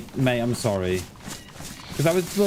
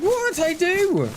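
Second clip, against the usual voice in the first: put-on much higher, a cheeky child answering back; mock cheeky kid; 13 semitones higher, slower than usual. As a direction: squeak it like a kid sassing his dad. Kid talking back to parent.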